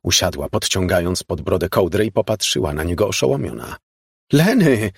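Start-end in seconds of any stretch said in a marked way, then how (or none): 4.3-4.9 loud, high-pitched call. A narrator's reading. Stunned woman calls to her lover, raised voice. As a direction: cry out to get through to someone. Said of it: "zawołała".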